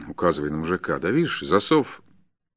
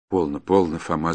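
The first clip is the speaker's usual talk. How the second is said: ordinary delivery, nothing marked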